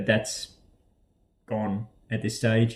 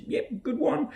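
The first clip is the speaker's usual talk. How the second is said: cheery friend; 7 dB quieter than usual. Retold or imitated. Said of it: "sent him a picture".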